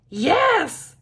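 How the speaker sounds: high, loud cheer; excited shout. A narrator's reading. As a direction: shout it out in triumph. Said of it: "Grumpy Todd shouts".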